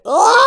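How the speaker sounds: high loud yelp; startled shout. Real, not acted. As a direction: let out a sharp cry. Burst of shouted reaction.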